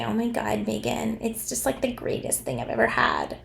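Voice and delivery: hushed mock Valley Girl gushing; fake Valley Girl. Commentator mimicking Jamie praising Meghan, soft fake Valley Girl. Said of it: "Valley Girl voice that she didn't have".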